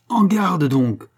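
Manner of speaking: high, loud call to arms; furious challenge. A narrator's reading. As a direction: challenge him to fight, furious. Challenge to a duel in fury. (défi furieux)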